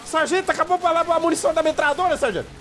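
high, frantic calls for the sergeant; hammed-up panic, begging for help; mock panic. Out of ammo, calling the sergeant in mock panic, high. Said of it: "O Elder em pânico".

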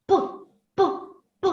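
short hard "П", repeated with pauses; crisp, separate hard "П" sounds. Shown as a demonstration. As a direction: pop out a non-palatalised "П". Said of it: "він вимовляється твердо".

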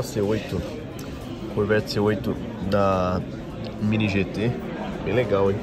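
usual speaking voice

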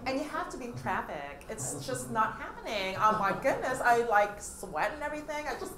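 hammed-up Valley Girl complaint, stretching the words; Valley Girl accent. Shown as a demonstration. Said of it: "Mga Valley Girl".

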